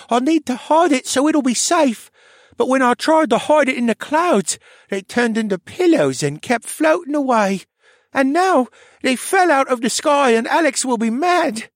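put-on high, dreamy child voice, fretful; a dazed little boy worried he'll be scolded; high, dazed child voice with rising worry; sleepwalking boy. A narrator's reading.

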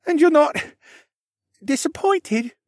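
put-on high, timid boy voice; anxious boy. Worried boy asks Alex if he disappointed him, high and timid. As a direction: ask it hoping for no.

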